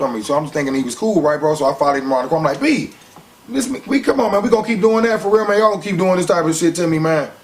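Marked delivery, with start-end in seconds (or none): 2.6-7.3 high, loud heated protest; confronting the boss, retold in a high loud voice; getting mad. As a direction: push back hard, voice climbing high and loud. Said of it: "Now I'm getting mad".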